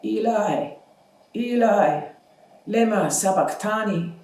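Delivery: Jesus crying out from the cross, anguished; anguished loud cry. Acted. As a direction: cry out to God in agony. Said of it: "Jesus cried with a loud voice".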